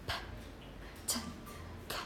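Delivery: "p, t, k" as separate puffs of air, no vowel; aspirated sounds. Shown as a demonstration. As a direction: breathe out each of "p, t, k" alone, pausing between. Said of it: "there is a certain degree of aspiration".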